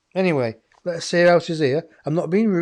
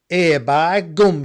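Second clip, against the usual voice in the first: slow, broad Yorkshire grandmother, louder; Yorkshire accent; 2 semitones higher, 7 dB louder, slower than usual. Retold or imitated. Medium channelling a Yorkshire grandmother, broad Yorkshire accent. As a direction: drawl a broad Yorkshire "Here by gum". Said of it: "she's got a Yorkshire accent".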